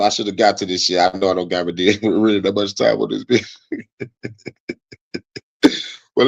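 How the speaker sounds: ordinary delivery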